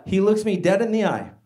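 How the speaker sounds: ordinary delivery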